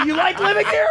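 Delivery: high, strained mock shout; mock marital yell. Spouse in a quarrel, yelling high. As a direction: hurl it across the house in mock anger.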